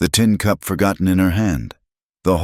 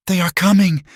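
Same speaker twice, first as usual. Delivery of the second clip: panicked shout, much higher; terrified cry; 4 dB louder than usual. A narrator's reading. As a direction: cry out in terror. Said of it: "her eyes wide with terror".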